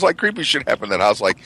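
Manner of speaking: ordinary talk, nothing marked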